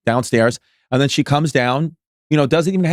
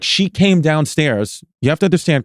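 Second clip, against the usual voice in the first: exasperated explaining. Retold or imitated. Retells partner's exasperated explanation.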